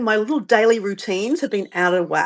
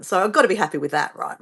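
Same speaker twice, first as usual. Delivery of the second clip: ordinary talk, nothing marked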